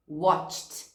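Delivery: "Watched" ends in a crisp t, one syllable; teacher models "Watched" as watch-t. Shown as a demonstration. Teacher shows students the past tense. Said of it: "Com som de T no final".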